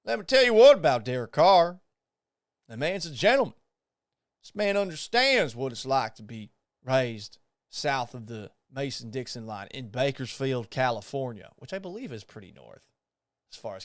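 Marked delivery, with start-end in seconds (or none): 0.0-11.5 mock Southern gentleman's accent; put-on Southern accent. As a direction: try on a playful Southern accent. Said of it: "Should I try one on for size?".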